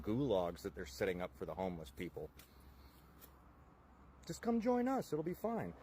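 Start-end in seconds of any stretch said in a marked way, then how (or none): 4.3-5.7 high, falsely cheerful invite; sarcastic cheer. Acted. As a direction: sing it out mock-friendly.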